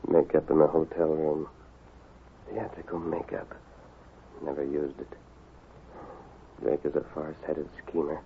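low, soft, halting musing; quiet private deduction with long pauses; pondering aloud. Acted. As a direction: piece the clues together under your breath. Piecing clues together in thought.

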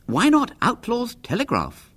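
high-pitched schoolboy voice; high boyish questioning voice; boy's suggestion. A narrator's reading. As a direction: propose it high, like a keen boy. Narrator voices Henry, a boy.

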